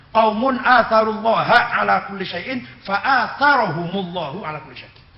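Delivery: slow, loud Arabic quotation; Arabic recitation. Real, not acted. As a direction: recite it slowly and forcefully, stretching the long vowels.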